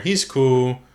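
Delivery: normal talking voice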